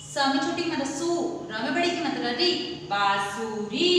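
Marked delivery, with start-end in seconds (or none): 2.9-3.9 बांसुरी stretched slowly, nasal बां; drawn-out syllables. Shown as a demonstration. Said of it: "उसके ऊपर चंद्र बिंदु लगाए". (बांसुरी धीरे-धीरे खींचकर)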